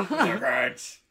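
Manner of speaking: high shouted Mister Grinch; shouted refrain. Pretend. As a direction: belt the name like a hype man.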